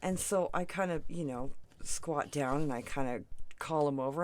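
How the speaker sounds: ordinary talk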